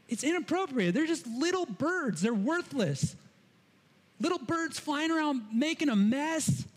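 high, huffy mock outrage at the birds; indignant objector. Imagined worshipper scolding the birds in the temple, huffy and high. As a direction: play the fussy worshipper who wants them gone. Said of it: "You might be tempted to think that".

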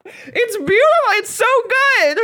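high, gushing praise. Real, not acted. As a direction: swoon over how good the scene is. Gushing over the scene.

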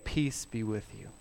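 hushed blessing. Pretend. Quoted blessing within Jesus' voice.

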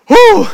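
sudden high, loud "huh"; high-pitched yelp of sudden alarm; startled hoot. Retold or imitated. Acts sudden stomach alarm.

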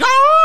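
high, loud "aah" scream; zombie yell. Retold or imitated. Blue-haired zombies all yelling as they chase, mock zombie yell. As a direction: let out a piercing mock horde yell. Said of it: "they're all yelling".

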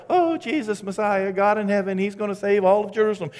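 high-pitched mock adoration of a savior; mock devotee. Preacher mimicking the believer Didymus was not, mock devotion. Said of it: "was not somebody who's like".

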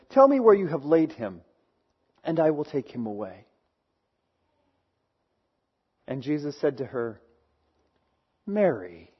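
low, hushed reading of the passage; a soft, reverent Bible reading; scripture reading. Acted. Pastor reads John 20 aloud.

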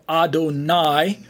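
"Adonai" ending in a long EYE sound; Adonai pronunciation. Shown as a demonstration. Teacher contrasting two Hebrew words, Adonai pronunciation. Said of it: "we get that pronunciation Adonai".

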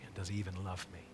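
a small wounded question; hurt doubt. Retold or imitated. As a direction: let it slip out like a private fear. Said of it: "It's very easy to say".

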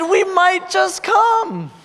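high, drawn-out delight; excited acceptance. Retold or imitated. Girl accepting the pastor's invitation, high and drawn-out. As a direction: stretch it out high and slow, thrilled.

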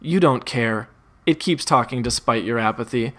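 ordinary delivery

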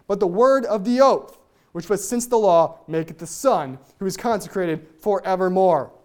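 resonant, deliberate Bible reading; solemn scripture reading. Acted. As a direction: proclaim the verses like a preacher at the pulpit.

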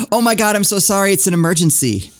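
hurried apology. Acted. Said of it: "and I'm like".

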